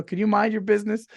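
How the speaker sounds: politely. Retold or imitated. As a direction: sweeten the request, no edge. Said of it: "I should have politely said".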